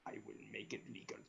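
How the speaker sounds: cold command from the shadows; Darth Sidious. Acted. Sidious voice held.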